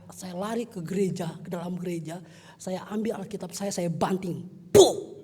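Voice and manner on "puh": explosive loud high "puh"; mimicked thud, short and forceful; imitated slam noise. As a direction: burst out a sudden impact sound. Imitates slamming down the Bible.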